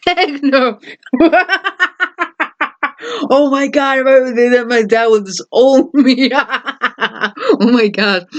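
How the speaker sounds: giggling through the words, high; laughing. Real, not acted. Vlogger picturing her dad's reaction, laughing. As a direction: laugh it off in disbelief.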